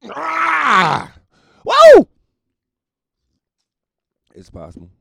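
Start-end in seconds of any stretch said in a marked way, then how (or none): 0.1-2.1 high whoop. Acted. Mock parent at coke hospital.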